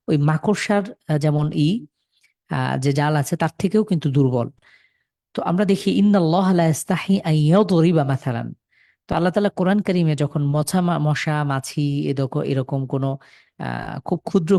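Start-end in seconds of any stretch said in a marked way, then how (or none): 5.9-8.5 Quran recitation. Acted. Quoting a Quran verse.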